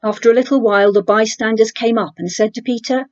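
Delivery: usual speaking voice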